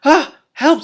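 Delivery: high, loud squeal for help; mock cry for help. Princess hearing the dragon may eat her, squealing for help. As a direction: squeak it out in hammed-up fright. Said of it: "said the princess".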